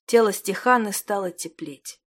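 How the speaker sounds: ordinary delivery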